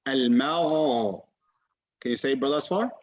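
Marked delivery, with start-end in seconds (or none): 0.1-1.2 Al-Magha drawn out, gh flowing freely from top of throat; slow Al-Magha, airflow never blocked; correct ghain. Shown as a demonstration. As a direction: model Al-Magha slowly with a gargled gh. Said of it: "the common mistake in Ghain is Ga".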